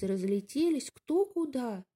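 plain reading voice

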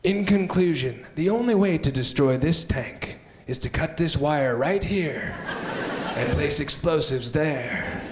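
low, slow documentary voice; documentary narrator. Retold or imitated. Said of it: "like, the Discovery Channel".

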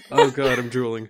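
helpless laughter in the voice; laughing. Real, not acted. Cracking up with co-host over a joke, laughing. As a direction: get it out while cracking up.

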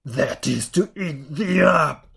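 loud, slow wolf threat; menacing wolf. A narrator's reading. Wolf pouncing on girl, loud menacing voice. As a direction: drop the disguise and threaten loudly, word by word.

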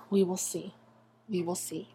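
a repeated whisper that dies away; hushed, fading We will see. Real, not acted. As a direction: whisper it like a spooky omen.

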